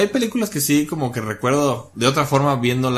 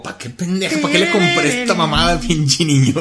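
exasperated mom; 5 semitones higher, 5 dB louder than usual. Retold or imitated. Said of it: "Están como".

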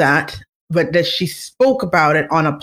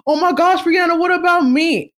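much higher, mock pleading complaint; mock high whine of disappointed fans; whiny heartbroken men; 10 semitones higher than usual. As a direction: mimic lovesick men begging for her attention. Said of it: "You guys are freaking hilarious the way you guys are like".

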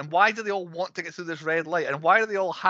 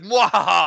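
evil laugh; 4 semitones higher, 10 dB louder than usual. Retold or imitated. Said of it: "they're all like".

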